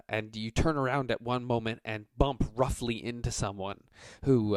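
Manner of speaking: ordinary talk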